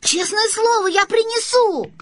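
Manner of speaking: put-on boy's high, insistent vow; earnest boyish plea. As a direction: plead earnestly to be believed. Boy pleads to be believed.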